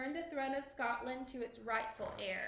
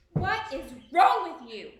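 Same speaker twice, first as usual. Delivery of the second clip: hissed; 3 semitones higher, 13 dB louder than usual. A narrator's reading. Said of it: "hissed Lady Macbeth".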